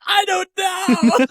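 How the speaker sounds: put-on very high, drawn-out kid's wail; mock wailing. Punched little brother answers the scoutmaster, mock wailing. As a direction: imitate a child bawling, slow and high.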